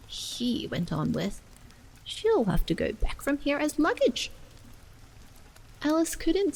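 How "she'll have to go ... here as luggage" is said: beetle. Acted. Beetle speaks.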